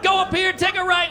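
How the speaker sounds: high, urgent mock panic; panicked directions. Teen directs the driver fleeing a pursuer, mock panic. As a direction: blurt directions in acted panic.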